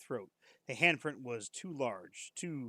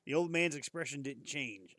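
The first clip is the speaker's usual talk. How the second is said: usual speaking voice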